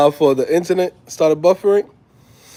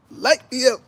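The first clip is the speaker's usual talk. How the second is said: mock Jamaican accent; 9 semitones higher than usual. Vlogger picturing a trip to Jamaica, mock Jamaican accent. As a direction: stretch it out in playful Jamaican. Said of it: "I'm gonna be in there like".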